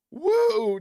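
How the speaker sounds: a high loud wrestler woo; wrestling woo. Acted. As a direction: let out a big Flair woo. Ric Flair style woo.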